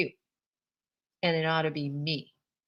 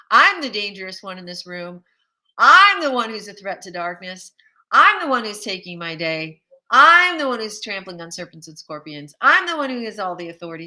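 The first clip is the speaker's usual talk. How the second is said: bold declaration; 8 dB louder than usual. Acted. Bold declarations over the darkness.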